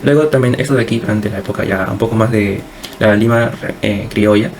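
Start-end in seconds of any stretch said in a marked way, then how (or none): none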